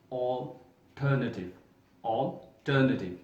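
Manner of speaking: alternative very slow, "ol-TER-na-tiv"; correct pronunciation. Shown as a demonstration. Teacher to students, very slowly modelling alternative. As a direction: stretch alternative out syllable by syllable. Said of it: "but this is not alternative".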